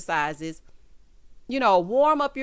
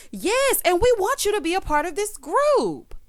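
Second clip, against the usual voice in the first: higher mock voice of a cast member; mock Irish; 10 semitones higher, 4 dB louder than usual. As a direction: parody her eager agreeing. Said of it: "Here go Irish".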